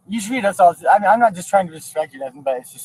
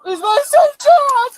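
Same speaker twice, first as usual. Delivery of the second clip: crying scream, high and loud; 4 dB louder, much slower than usual. Real, not acted. Mother crying out for her shot son, screaming. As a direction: scream it through tears.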